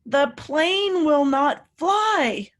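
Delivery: normal talking voice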